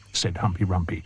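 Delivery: ordinary delivery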